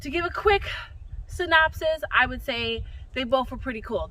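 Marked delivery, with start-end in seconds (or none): none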